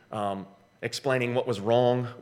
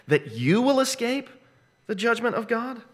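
rising emphasis; 9 semitones higher than usual. Acted. Preacher reading Romans rising pitch.

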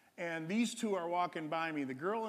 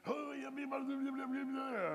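high mock chatter of the dad; high, dismissive imitation of the father talking; imitating babble; 9 semitones higher, slower than usual. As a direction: toss off the blahs in a high voice. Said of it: "he comes up to me and".

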